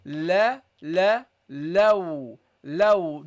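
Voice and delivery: teacher drills light lam. Shown as a demonstration. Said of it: "Don't make this heavy".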